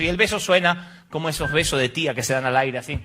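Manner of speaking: usual speaking voice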